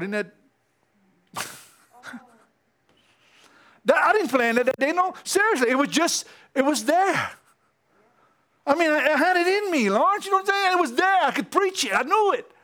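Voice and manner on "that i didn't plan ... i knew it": high, loud and fired up; can't contain the excitement; excited. Real, not acted. Telling Lawrence about preaching, high excited rush.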